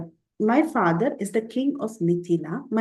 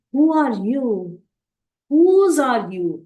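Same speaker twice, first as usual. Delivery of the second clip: louder, slow, probing demon king; demanding Ravana; 5 semitones higher, 6 dB louder, slower than usual. Retold or imitated. Ravana loudly, slowly questioning Sita in the forest. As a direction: press her slowly and loudly, who she belongs to. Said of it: "he says".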